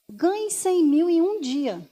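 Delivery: mock get-rich-quick commercial; ad announcer. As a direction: sell it like a TV ad, drawing out "cem". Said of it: "aquele que fala assim".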